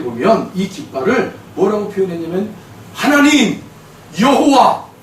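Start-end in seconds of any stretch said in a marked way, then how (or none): 2.9-4.9 1 emphatic proclaiming. Real, not acted. Preacher exclaims names of the flag.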